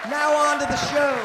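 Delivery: loud, high show announcer voice; emcee announcement. Acted. To the club crowd, a booming emcee introduction. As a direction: call the next act up to the stage. Host announces the next act.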